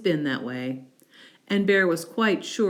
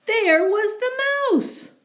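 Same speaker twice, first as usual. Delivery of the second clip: much higher, louder reveal of the mouse; surprised reveal; 4 dB louder than usual. A narrator's reading. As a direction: gasp it out, the mouse again.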